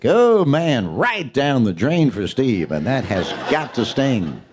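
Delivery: hammed-up pinball commentary; mock play-by-play. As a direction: call the pinball like a TV sportscaster. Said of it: "I would call pinball".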